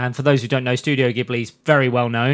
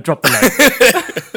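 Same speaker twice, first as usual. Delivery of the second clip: slow, high, hammed-up bomber call; drawn-out seagull voice; 7 semitones higher, 5 dB louder, much slower than usual. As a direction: stretch it out like a cartoon bird. Voicing the seagull, stretched.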